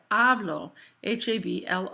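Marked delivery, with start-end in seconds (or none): 0.1-0.7 "hablo" stressed on the HA; stress on first syllable. Shown as a demonstration. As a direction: hit the start of "hablo", let the end drop. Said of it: "to show that it's stressed".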